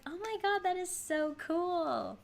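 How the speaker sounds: high, drawn-out excited gush. Retold or imitated. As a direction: gush slowly with delight. Retold excited reaction.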